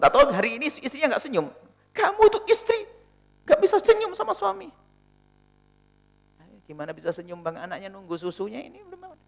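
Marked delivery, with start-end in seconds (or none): 1.9-4.7 much higher, put-on voice of a nagging husband; hammed-up husband complaining to his wife; mock scolding husband. 6.4-9.2 quieter, meek answer back; a wife's soft-voiced retort to her husband; soft wife's reply.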